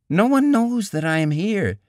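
high, protesting woman's voice; protesting young woman. A narrator's reading. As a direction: voice her as a young woman objecting. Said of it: "she protested".

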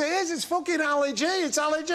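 high-pitched imitated pub bloke; mock-excited, high-voiced celebrity spotter; excited recognition. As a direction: squeal it like an overexcited fan.

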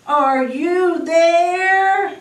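high, loud, sustained singsong call. A narrator's reading. Friends searching the woods for Bear, calling out loudly. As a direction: call into the distance, stretching the last word. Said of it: "They called".

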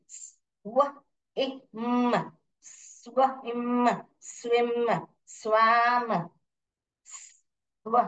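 "स्व" and "इम" apart, then joined slowly as "स्विम"; phonics blending. Shown as a demonstration. Teacher demonstrating consonant blend, slow phonics sounding-out. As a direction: hold the final m of "स्विम", slowly. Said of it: "ये सब consonant blend है".

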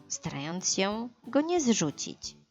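usual speaking voice

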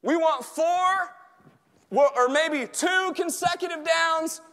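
high, loud, drawn-out rallying cry; full-force preaching. Real, not acted. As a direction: stretch and shout each goal to the team. Preaches football analogy at full force.

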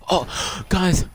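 gasping, high-pitched squeal of guys; can't believe his luck, gasping out guys over and over; stunned excitement. Real, not acted. Gamer gets a prized weapon in a crate, excited high-pitched gasping. Shocked at getting the Lefty.

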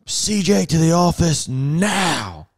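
slow, drawn-out summons over the bullhorn; calling the boy in like a stern boss; mock stern page. Father paging his son to the office, slow and stern. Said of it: "I could tell by his tone of voice".

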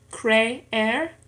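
"creer" in two beats, cre, er; syllable by syllable. Shown as a demonstration. Learner showing two syllables in an exercise, "creer" split apart. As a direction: split "creer" with a gap between the two e's. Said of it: "en dos sílabas".

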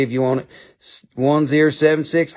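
ordinary talk, nothing marked

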